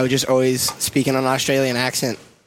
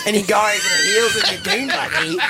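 put-on Australian accent; 12 semitones higher than usual. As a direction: shout it out like a broad outback Aussie. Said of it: "You do a good Australian".

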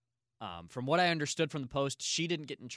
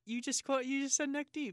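excited squeal. Real, not acted. Delighted at a band name.

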